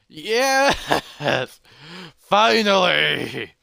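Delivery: loud, high, drawn-out cheer with laugh; whooping, laughing relief; triumphant rejoicing. Acted. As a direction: exult after a long search. Hero rejoices on finding city.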